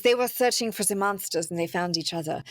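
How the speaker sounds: put-on French accent slipping into German; a clumsy French accent that comes out German; botched French accent. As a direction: try French, land on a mock German accent. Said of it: "No, that's German".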